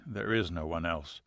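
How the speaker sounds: tender reassurance. A narrator's reading. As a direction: comfort her quietly. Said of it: "he said tenderly".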